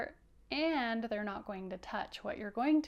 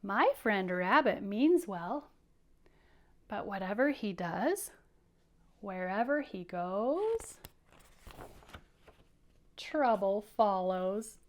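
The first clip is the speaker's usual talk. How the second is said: slow, louder, measured phrasing; storytelling read; 4 dB louder than usual. Acted. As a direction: read it slowly and weightily. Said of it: "Mouse says".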